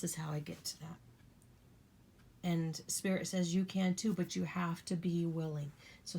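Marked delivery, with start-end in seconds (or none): 0.0-1.0 soft unvoiced whisper, almost to herself. Real, not acted. Telling viewers how she atones, whispered. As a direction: confide it under the breath. Intimate aside about her practice.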